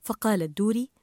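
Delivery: ordinary delivery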